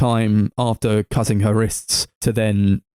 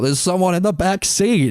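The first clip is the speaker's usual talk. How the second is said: mock shout, pitched up high; retold shout; 9 semitones higher than usual. Retelling the film's warning, mock shout. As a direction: imitate a shout at normal volume, higher. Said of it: "like, then shout".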